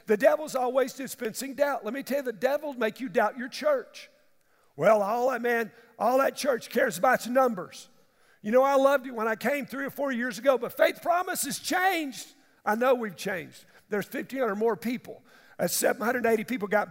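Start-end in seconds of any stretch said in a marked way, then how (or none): 4.8-12.3 louder, higher griping; complaining member. Retold or imitated. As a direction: gripe like a disgruntled member. Complaining churchgoer voiced.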